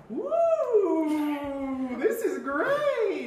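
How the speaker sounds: high, slow, overjoyed squeal; hammed-up cheer. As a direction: gush with fake delight, drawn out. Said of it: "But she's like".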